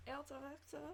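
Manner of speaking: hammy fake German, high and soft; fake German accent. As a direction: say it like a mock German. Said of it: "My fake German might be better".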